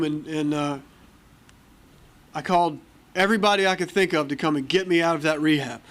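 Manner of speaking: ordinary talk, nothing marked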